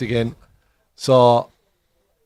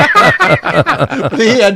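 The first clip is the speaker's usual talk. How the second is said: much louder, slow, laughing hard; laughing punchline; 8 semitones higher, 14 dB louder, much slower than usual. Real, not acted. Teller delivering the payoff to friends, laughing.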